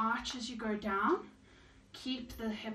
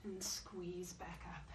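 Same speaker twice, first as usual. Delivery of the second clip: slow, softer whispered coaching cue; 5 semitones lower, 12 dB quieter, slower than usual. Shown as a demonstration. Fitness instructor to class during squats, slow whisper.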